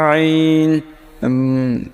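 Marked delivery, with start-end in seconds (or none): 0.1-0.8 আইন squeezed low in the throat, held long; deep-throat ain. Shown as a demonstration. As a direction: start আইন from the very back of the throat. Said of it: "একেবারে শেষ ভাগ থেকে". (গলার গভীর থেকে আইন)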